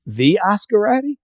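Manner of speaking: high, rising astonished question; can't believe who's standing there; stunned disbelief. Retold or imitated. Meeting his idol in disbelief.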